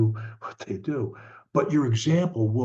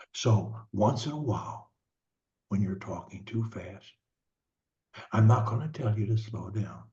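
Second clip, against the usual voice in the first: ordinary talk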